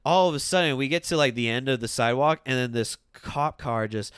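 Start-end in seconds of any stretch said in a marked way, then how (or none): none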